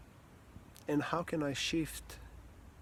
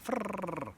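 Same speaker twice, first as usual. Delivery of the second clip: high trilled frrrr; rapid whirring noise; 7 semitones higher than usual. Shown as a demonstration. Coach shows how fast their mind works, trilled whirr. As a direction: whirr it like something zooming past. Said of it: "everything happens in milliseconds and seconds".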